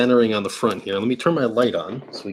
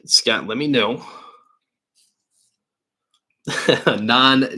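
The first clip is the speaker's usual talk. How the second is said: ordinary delivery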